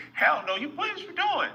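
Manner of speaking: squeaky high outraged woman; high shocked woman. Retold or imitated. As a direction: voice her expected outrage. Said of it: "she was gonna say".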